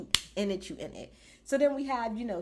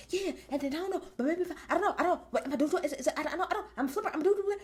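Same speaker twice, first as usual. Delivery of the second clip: higher, rambling, half-laughing; flustered Rhea; 6 semitones higher, much faster than usual. Retold or imitated. Rhea rambling to Damian Priest backstage, higher and flustered. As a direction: babble like you've lost the plot mid-demo. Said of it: "And she's like".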